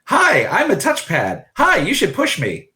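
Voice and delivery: high, bright little machine greeting; chirpy touchpad voice. Acted. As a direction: chirp like an eager gadget. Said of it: "and it says".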